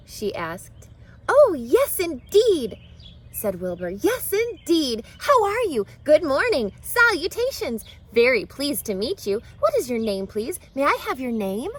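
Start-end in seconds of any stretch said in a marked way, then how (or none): 1.3-11.7 high and loud, gushing; excited pig. Acted. Wilbur meeting Charlotte, high excited gushing. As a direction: gush out every greeting at once.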